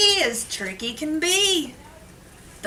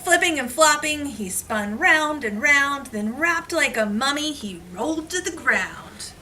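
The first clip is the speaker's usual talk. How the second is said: put-on reading to children; 4 semitones higher than usual. Reads picture book to class.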